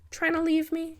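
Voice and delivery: put-on cheeky boy. A narrator's reading. Boy challenges.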